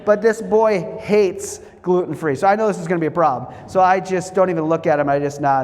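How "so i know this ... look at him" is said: usual speaking voice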